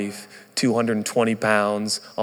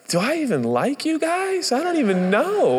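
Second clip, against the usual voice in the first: much higher, incredulous and half joking; exasperated aside. Retold or imitated. New pastor to his doubting church, exasperated. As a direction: ask it with comic disbelief.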